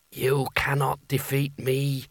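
slow, drawn-out voice of a sorcerous hat; the hat's voice. A narrator's reading. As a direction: speak as a commanding magical hat, stretching each word. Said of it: "he said in the hat's voice".

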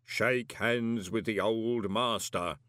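slow, drawn-out ritual voice; mysterious master speaking out of the dark, very slow; voice in darkness. A narrator's reading. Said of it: "a voice in the darkness had said".

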